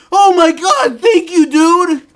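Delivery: high, loud, half-crying thanks; overjoyed cry. Retold or imitated. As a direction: gush like it's a fortune. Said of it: "that's how I said it".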